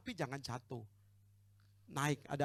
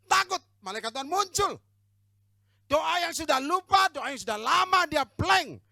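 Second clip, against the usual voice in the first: loud, high, slow preacher's cry; rousing sermon shout; preaching at full force; 12 semitones higher, 16 dB louder, slower than usual. Acted. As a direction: thunder it out from the pulpit.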